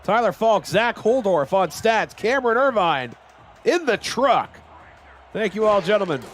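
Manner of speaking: high, bright announcer read; announcer credits read. Acted. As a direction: call out the crew names.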